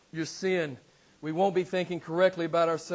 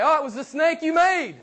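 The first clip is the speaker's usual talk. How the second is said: higher, louder flustered blame; Eve's excuse; 9 semitones higher, 8 dB louder than usual. Retold or imitated. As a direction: Eve pointing at the snake, pitched up. Eve blames the snake.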